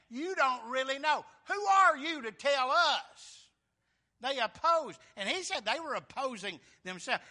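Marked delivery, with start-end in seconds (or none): 0.1-3.4 loud, high, indignant comeback; defiant retort. Acted. Opponents snapping back at Paul, loud and high. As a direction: throw it back at him, offended.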